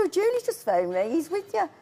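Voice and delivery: retold baffled insistence, pitch climbing; disbelieving protest. Sister argues with John on the phone, disbelieving. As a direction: protest in confusion, rising high at the end. Insisting he was reported safe.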